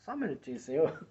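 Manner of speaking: hushed angel putting God on hold; soft, deferential aside in a comic skit; telemarketing angel. Pretend. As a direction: ask for a moment, very softly. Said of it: "Tá lá o anjo lá".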